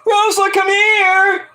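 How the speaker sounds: high slow mock call for Rosa; drawn-out high call. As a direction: holler across the house. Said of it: "he's like".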